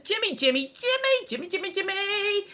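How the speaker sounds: high sing-song, rising repeats of a name; hammed-up name calling. Host announcing listener Jimmy's message, high playful sing-song.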